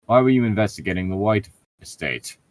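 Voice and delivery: ordinary delivery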